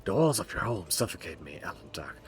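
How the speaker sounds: whispered confession; whispering. Acted. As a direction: hush it out, secretive. NPC confides secretly.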